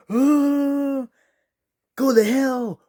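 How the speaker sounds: very high mock shriek; old man screaming. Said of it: "Old man is like".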